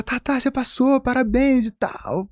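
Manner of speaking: high and drawn-out congratulations; congratulating friend. Retold or imitated. Friend tells Tatá he passed, high slow cheer. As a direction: sing out the good news slowly.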